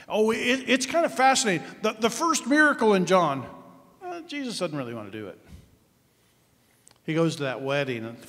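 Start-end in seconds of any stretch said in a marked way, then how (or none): none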